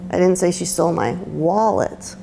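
wallet weighted with a falling tune; stress on WALLET. Shown as a demonstration. Teacher ending the emphasis demo for the class, stress on wallet. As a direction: land hard on the word wallet. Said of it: "every word that you put emphasis on".

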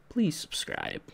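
soft unvoiced whisper. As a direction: breathe it as a whisper. Whispered aside.

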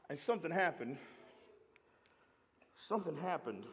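low, soft, with long pauses; hushed suspense. Real, not acted. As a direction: drop it down and let it hang. Hushed suspense before the sermon's turn.

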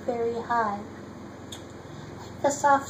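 ordinary delivery

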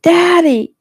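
very high, loud cry for help; child's scream. A narrator's reading. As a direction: scream for a parent in terror. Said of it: "Jeffy screamed".

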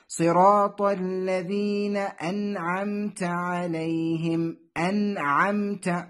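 "أنعمت" slowly recited with a clear n before ayn; clear nun. Shown as a demonstration. Teacher demonstrates the rule, "أنعمت" with distinct n before ayn. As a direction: sound the n of "أنعمت" clearly, unmerged. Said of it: "جاء بعد النون الساكنة فتظهره".

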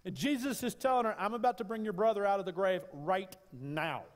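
high, loud climactic preaching cry; full-throated revival preaching; preaching at full force. Acted. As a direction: lift it to a soaring shout from the pulpit.

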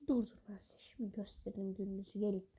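hushed threat, breathy and low; whispered taunt at the game enemies; menacing whisper. Real, not acted.

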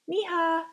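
"Miha" with no R, said flatly; name mispronounced. Acted. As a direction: another kid dropping the R in "Miha". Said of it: "their R sounded wrong".

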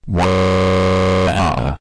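long, monotone "waaah" held on one note; robotic sustained wail; drawn-out wail. Acted. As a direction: hold a toneless cry, then drop off. Same wordless cry in acted quarrel.

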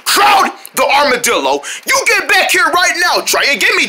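ordinary talk, nothing marked

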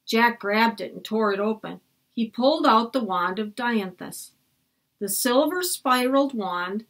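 plain reading voice

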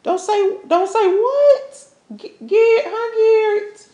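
high, loud mocking questions; defiant, high-pitched needling; taunting challenge. Acted quarrel, Darla taunts Garrett.

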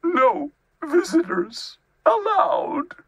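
high, wailing bear; word by word, a high weepy plea; tearful insistence. A narrator's reading. Tearful bear lays down rule.

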